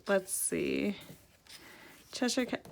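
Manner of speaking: normal talking voice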